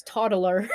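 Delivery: put-on TODDLER with an extra middle syllable, tod-uh-ler; mispronounced TODDLER. Quoting coworkers' mistake, TODDLER said as tod-uh-ler. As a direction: stretch TODDLER into three syllables. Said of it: "people can't pronounce the word toddler".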